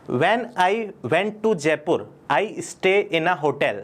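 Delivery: drawn-out sample sentence for learners; slow example sentence. Shown as a demonstration. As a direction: say it slowly, word by word. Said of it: "example sentence".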